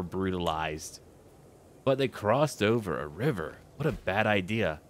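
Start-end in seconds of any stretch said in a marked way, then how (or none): none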